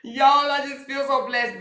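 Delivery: high, loud mock gratitude; mocking impression. Repeating Janky's fake thanks to viewers, high and loud. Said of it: "She's like".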